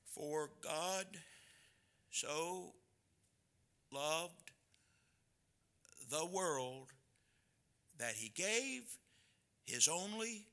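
halting recital, long pauses between every word; a child groping word by word through a verse; little boy reciting. Retold or imitated. Little boy stumbling through John 3:16 for his dad, halting. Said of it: "He said the word forgotten".

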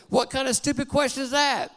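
a mild mock fury; father fumes at his son's question; furious thought. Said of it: "becomes even more furious".

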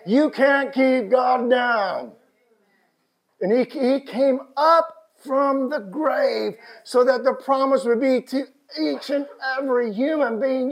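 loud, high proclamation with stretched words; triumphant preaching. Real, not acted. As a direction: proclaim it over the congregation at full voice. Preaches resurrection at full force.

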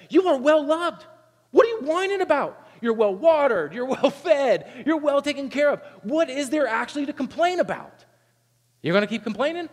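high, loud mock exasperation at whining children; exasperated parent. Parent scolding whining kids who have everything, high exasperated scold. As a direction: play the fed-up parent scolding.

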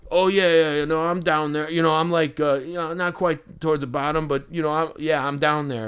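mock hasty agreement to save face; a hint of a flustered braggart backing down; flustered backpedalling. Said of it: "he didn't know, like, what to say".

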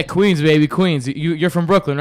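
normal talking voice

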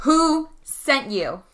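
slow, measured interrogation of an attacker; deliberate, spaced-out questioning, not yet yelling; slow demand. Acted. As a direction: draw out each word as a demand. Confronting a downed attacker.

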